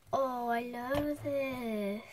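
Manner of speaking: usual speaking voice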